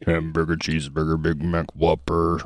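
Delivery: muffled wah wah talk. Acted. Muttered food list.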